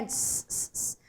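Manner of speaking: a soft, voiceless "s, s, s"; isolated S sound. Shown as a demonstration. Teacher demonstrating the digraph's only sound, isolated S. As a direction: hiss each "s" alone, quietly. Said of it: "único som de S".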